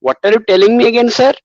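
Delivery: put-on Indian English accent; mock Indian accent. Shown as a demonstration. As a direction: imitate an Indian caller asking for a repeat. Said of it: "Indian is will drive you crazy".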